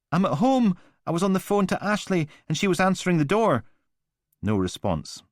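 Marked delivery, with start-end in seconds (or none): 0.1-3.6 high, fast, frightened rush; panicked caller. Acted. Frightened woman telling the operator where she is, fast and high. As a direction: gabble it out in fright.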